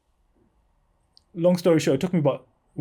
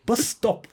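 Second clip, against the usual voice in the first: BUS STOP high, each word set apart; emphatic correction; 8 semitones higher than usual. Retold or imitated. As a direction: spell out "bus stop" for someone who misheard. Correcting a mishearing on phone.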